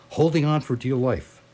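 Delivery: normal talking voice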